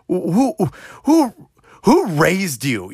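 high, stuttering disbelief, last words slow; stunned outrage climbing high then dragged out; incredulous. Retold or imitated. Retold outrage at rude kids.

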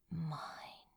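breathless, unvoiced whisper of "Mine". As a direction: breathe the word like a secret claim. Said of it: "Crow whispered".

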